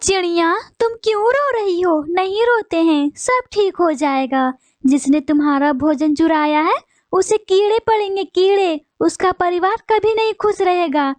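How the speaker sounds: high bird voice soothing the crying sparrow. Acted. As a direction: comfort her, then curse the thief with sudden heat. Another bird consoles the sparrow. (रोती चिड़िया को दिलासा देती ऊँची आवाज़ वाली चिड़िया)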